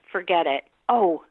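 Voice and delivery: normal talking voice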